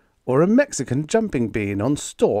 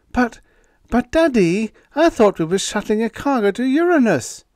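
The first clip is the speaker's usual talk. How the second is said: daughter's protest; 12 semitones higher, 6 dB louder than usual. A narrator's reading.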